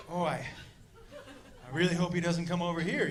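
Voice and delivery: low, soft, hesitant aside; uneasy inner thought. Retold or imitated. Onlooker watching shirtless dude, low uneasy aside. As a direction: say it privately, dreading his approach.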